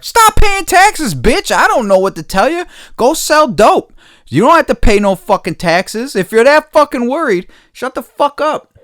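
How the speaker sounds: high, loud furious yell; angry shouting. Real, not acted. Shouting down a taxpayer who complains about funding wars, furious and loud. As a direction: yell it right at him, fed up. Ranting at a critic online.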